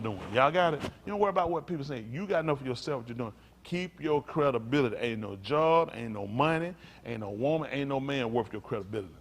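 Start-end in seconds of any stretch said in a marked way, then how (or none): none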